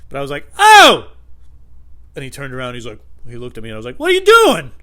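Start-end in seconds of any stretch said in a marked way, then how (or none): 0.6-1.1 high, very loud yelp; hammed-up outraged shout; retold scream. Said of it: "I'm screaming that like that". 4.0-4.7 high, very loud confronting yell; hammed-up outraged shout; screamed challenge. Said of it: "I'm screaming that like that".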